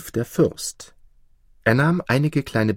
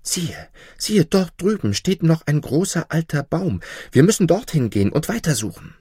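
high, urgent dwarf, speeding up; excited call; 8 semitones higher than usual. A narrator's reading. Dwarf spots a tree and calls the goose, excited. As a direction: point it out eagerly, voice lifted. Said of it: "und er rief".

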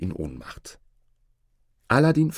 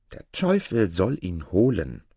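plain reading voice

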